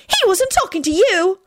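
put-on very high girl's voice; shrill scolding. A narrator's reading. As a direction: put the bully in his place shrilly. Shrill girl scolds the bully.

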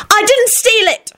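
very high, slow indignant denial; high-pitched, wounded protest; yelling back. A narrator's reading. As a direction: yell it back defensively. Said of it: "she yelled back".

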